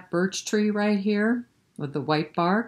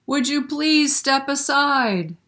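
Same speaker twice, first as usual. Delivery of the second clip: calling out; 8 semitones higher, 5 dB louder, slower than usual. Acted. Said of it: "so he called to the moose".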